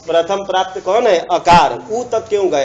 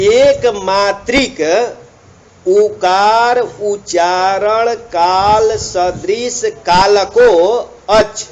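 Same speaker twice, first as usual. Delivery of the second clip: slow, deliberate recitation of the commentary; reading the text aloud; 2 semitones higher, 4 dB louder, much slower than usual. Shown as a demonstration. Teacher reading the commentary to students, slow recitation. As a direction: read each word out slowly for the class.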